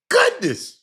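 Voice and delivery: high loud oh my goodness; high excited exclamation; excited exclaim. Real, not acted. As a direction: burst out with delight.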